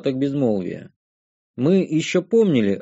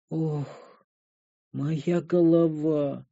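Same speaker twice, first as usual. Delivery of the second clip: slow hungover moan, mouth nearly closed; mumbling moan; 6 dB quieter, much slower than usual. A narrator's reading. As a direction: moan it through closed lips. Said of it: "промычала Карамель".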